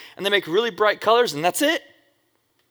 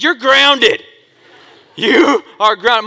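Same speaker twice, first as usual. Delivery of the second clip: much louder, higher scolding mom; angry mom; 6 semitones higher, 11 dB louder, much slower than usual. Retold or imitated. Mom catching her son after the cops, loud angry scolding. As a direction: bark it like a furious mother.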